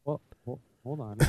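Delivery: distant faint voice; muffled, from the next room; from another room. Real, not acted. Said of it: "Stop yelling from the kitchen".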